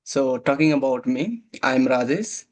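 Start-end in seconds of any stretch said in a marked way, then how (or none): none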